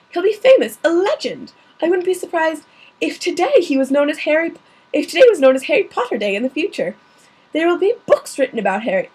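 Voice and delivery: a hint of a worried schoolmistress; McGonagall voice. Reader voices McGonagall's complaint.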